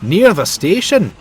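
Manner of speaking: with a grin. A narrator's reading. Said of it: "Donald replied with a grin".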